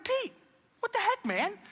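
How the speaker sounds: high, baffled "what the heck" to a friend; mock disbelief at Peter's boast; incredulous fellow disciple. Disciples baffled at Peter's boast, high incredulous voice. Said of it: "I'm like".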